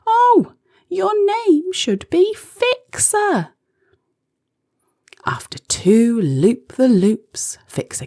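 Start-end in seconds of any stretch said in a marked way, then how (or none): 0.1-3.5 high, slow joyful exclamation; delighted. Acted. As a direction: beam as you name him. Joyful naming of crow.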